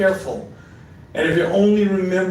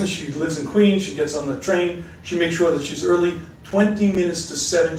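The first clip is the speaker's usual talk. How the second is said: ordinary talk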